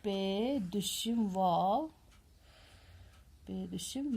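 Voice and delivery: letters of پوفی spelled out very slowly; slow spelling. Shown as a demonstration. Teacher spelling پوفی for pupils, drawn out slowly. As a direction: stretch each letter name for the children. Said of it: "کیا بولتے ہیں؟".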